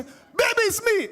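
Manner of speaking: high-pitched, mock-panicked cry; hammed-up yelp of alarm; panicked wife. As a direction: squeal it like a startled wife pleading for recognition. Voicing wife being strangled by soldier.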